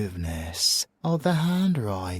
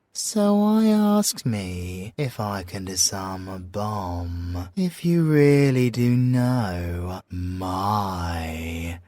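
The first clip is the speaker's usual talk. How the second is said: ordinary talk, nothing marked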